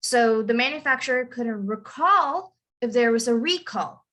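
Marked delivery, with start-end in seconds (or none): 1.2-2.5 "recall" as verb, weight on "-call"; stress on second syllable. Shown as a demonstration. Teacher rereads the example sentence, "recall" stressed on -call. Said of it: "emphasize the end". 3.3-4.0 "recall" as noun, weight on "RE-"; stress on first syllable. Shown as a demonstration. Teacher rereads the example sentence, "recall" stressed on RE-. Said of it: "emphasize the beginning".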